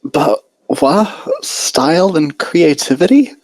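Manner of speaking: baffled, questioning the rules; Twilight confused. A narrator's reading. Twilight to Rarity, a confused high question.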